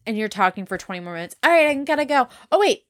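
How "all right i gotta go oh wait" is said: high-pitched mock caller, starting up afresh; chatty phone caller. Caller saying bye then carrying on, eager chatter. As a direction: imitate someone who can't end a call. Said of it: "this is why I don't talk on the phone".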